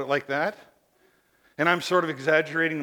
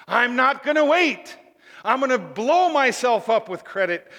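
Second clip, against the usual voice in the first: impatient demanding; 4 semitones higher than usual. Acted. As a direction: demand it like a spoiled consumer. Mimics an impatient generation.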